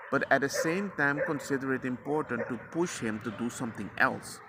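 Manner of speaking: ordinary talk, nothing marked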